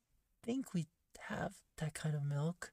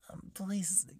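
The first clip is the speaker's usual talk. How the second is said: timid hushed maid; 3 semitones higher, 3 dB quieter than usual. Acted. Shy plea for more pats.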